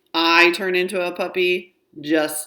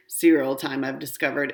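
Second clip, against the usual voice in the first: ordinary delivery, nothing marked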